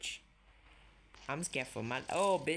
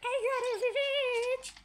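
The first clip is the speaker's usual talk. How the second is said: "I got a revenge" in a very high, loud squeaky voice; squeaky repeat; 5 dB louder than usual. Acted. Player repeating her threat to the enemy in a squeaky voice. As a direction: squeal it like a cartoon.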